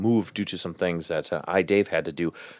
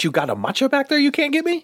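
mock plea. Mock pleading to the waiter.

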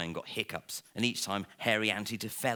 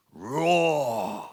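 big louder roaring "Roar!", voiced as a lion; lion's roar; 6 dB louder than usual. A narrator's reading. Bald lion Mister Gronk leaping up, giving a big loud roar. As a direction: let out the biggest roar in the world. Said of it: "gave the biggest, loudest roar".

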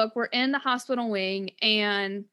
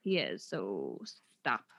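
much lower, quiet disapproving mother; stern mother voice; 9 dB quieter than usual. Retold or imitated. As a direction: drop low and shut her down. Missus Weasley voiced, dismissive.

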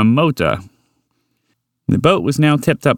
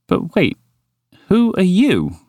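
high-pitched insistent question; demanding; 9 semitones higher, slower than usual. Acted. Walter demanding the strange horse's name, insistent. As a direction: demand to know, pitch jumping up. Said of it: "Walter demanded".

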